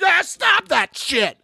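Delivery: high, slow hammed-up yell; mock shout. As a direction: yell it like a fed-up viewer.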